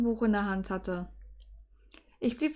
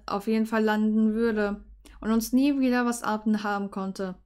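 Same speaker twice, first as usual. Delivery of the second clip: plain reading voice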